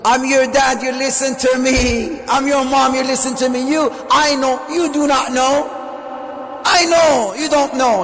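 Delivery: high, loud lecturing parent, building to a shout on "I know"; bossy parent shouting. Retold or imitated. Parent shouting down at their child, domineering. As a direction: talk down at the kid like a tyrant. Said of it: "how do we talk at our kids".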